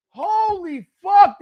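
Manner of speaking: high, slow, drawn-out yell; disbelieving shout again. Real, not acted. Still reeling at the play, slow loud disbelief. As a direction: drag it out, stunned.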